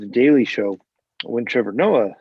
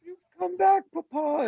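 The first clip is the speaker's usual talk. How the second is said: put-on much higher, kid-like delighted cry; mock tearful joy. Trevor Noah greeting Stewart, hammed-up high childish joy. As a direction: play the overjoyed child welcoming dad home. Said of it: "and he's like".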